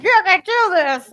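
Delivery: high, loud cheering coach; a coach cheering on a gymnast, high and loud; pep-talk coach. Retold or imitated. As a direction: pump her up like a coach. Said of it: "her coach is like".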